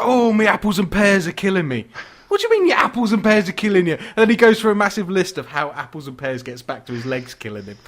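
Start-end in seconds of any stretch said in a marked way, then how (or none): 0.0-1.9 put-on complaining old geezer. Old geezer in a wheelchair grumbling in rhyming slang, hammed-up complaint. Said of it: "the old geezer in a wheelchair". 2.3-3.9 high, loud, quick baffled retort; mock incredulous. Baffled listener answering the old geezer's slang, mock incredulous.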